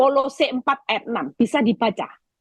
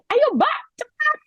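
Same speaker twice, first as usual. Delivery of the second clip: re-enacted high, urgent hurrying call; sharply raised, impatient-sounding mock order; high-pitched intonation; slower than usual. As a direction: hurry the helper along in a high voice. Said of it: "setelah nada intonasi saya tinggi".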